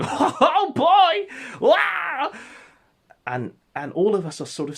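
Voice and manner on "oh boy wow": very high, loud whooping exclamations; loud high-pitched cartoonish hollering; Gator impression. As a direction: whoop it out, shrill and over the top. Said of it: "is Gator going".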